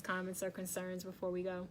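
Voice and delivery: ordinary talk, nothing marked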